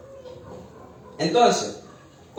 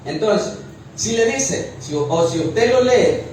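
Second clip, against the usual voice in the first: ordinary talk, nothing marked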